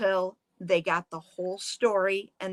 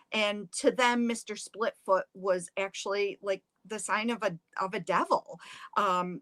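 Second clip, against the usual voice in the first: plain reading voice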